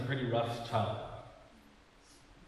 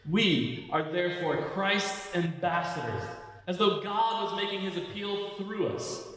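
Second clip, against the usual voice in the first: higher, projected preacher's reading; scripture reading; 7 semitones higher, slower than usual. Real, not acted. Pastor reading Paul's letter to the congregation, proclaiming scripture. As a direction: read scripture aloud with emphatic, measured phrasing.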